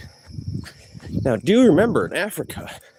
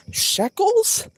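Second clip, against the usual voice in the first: surprised squeak. Real, not acted. Surprised question about currency name.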